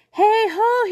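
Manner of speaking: high, loud call across the water; shouted hail. A narrator's reading. Birdling hailing the Nautilus fleet, a high loud shout. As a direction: hail the ships loudly. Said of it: "he shouted".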